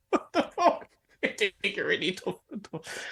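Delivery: high, helpless laughing read; can't keep straight face. Real, not acted. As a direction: lose it laughing while reading aloud. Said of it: "couldn't read it with a straight face".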